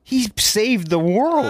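high and slow, drawn-out plea; pleading squeal. Acted. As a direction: stretch it out high, begging them. Defending Samwise.

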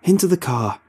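high, loud yelled order; bellowing. A narrator's reading. Robbie yells at Casey to get in the car: high loud yell. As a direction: bark it like the car's their only escape. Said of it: "Robbie bellows".

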